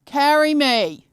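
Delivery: high, slow lazy plea; whining at the watch; lazy whine. Retold or imitated. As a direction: drag it out like a spoilt child. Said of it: "I might just go".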